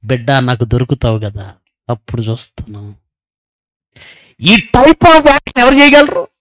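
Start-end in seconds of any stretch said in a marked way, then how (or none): 0.0-2.9 soft, low menacing threat; inner threat. Retold or imitated. Hypocrite's inner voice vowing revenge, soft and menacing. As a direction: say it under your breath, full of spite. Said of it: "లోపల".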